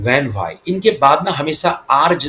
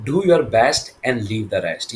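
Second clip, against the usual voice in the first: slow model sentence; 2 semitones lower, much slower than usual. Shown as a demonstration. Teacher models English proverb.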